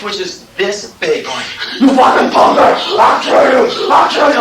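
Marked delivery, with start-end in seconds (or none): 1.8-4.4 high, loud crazed shouting; enraged threat. Retold or imitated. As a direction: scream it like a raging attacker. Knife-wielding attacker threatens to kill him.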